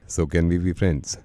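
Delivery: deep and slow like a shy creep; low shy creep voice. Acted. Prank caller flirting with woman, low slow voice.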